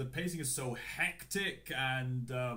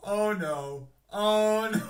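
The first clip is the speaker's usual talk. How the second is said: louder laughing groan; 5 semitones higher, 11 dB louder, slower than usual. Real, not acted. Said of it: "I'm laughing because".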